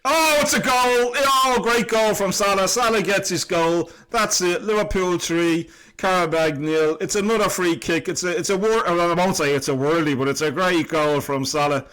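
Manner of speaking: high, loud goal celebration; excited goal call. Real, not acted. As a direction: shout it like the ball just hit the net. Salah scores a goal.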